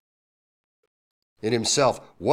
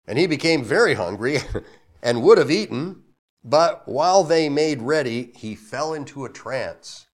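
louder formal Bible reading with a laugh inside; scripture reading; 4 semitones higher, 7 dB louder than usual. Acted. Pastor reading about hungry Peter to the church, amused. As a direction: read the verse out, chuckling at the hungry part.

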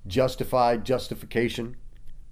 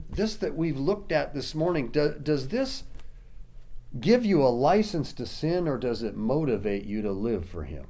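ordinary delivery

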